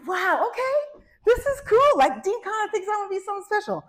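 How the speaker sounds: put-on a kid thrilled by the dean's praise; excited. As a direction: sound like you just got great news. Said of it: "I was like".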